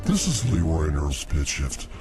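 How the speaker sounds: normal talking voice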